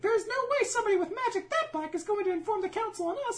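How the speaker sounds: put-on very high piping child voice; little girl voice. Imitating the little girl in the show, very high and piping. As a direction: squeak it like a tiny girl. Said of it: "she's like".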